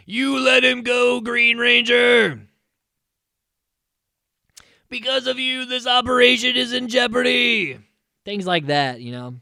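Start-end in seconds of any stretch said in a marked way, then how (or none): 0.1-2.5 loud, higher, dragged-out scolding; mock villain taunt. Goldar taunting the Green Ranger, loud slow villain yell. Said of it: "Goldar just shit talks Tommy like". 4.9-7.8 loud, higher, dragged-out scolding; mock villain taunt. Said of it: "Goldar just shit talks Tommy like".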